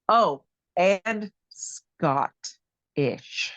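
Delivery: very slow, stretching each word of "and Scottish"; savoring drawn-out delivery. Real, not acted. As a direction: linger over "Scottish", syllable by syllable. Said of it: "the way you said that".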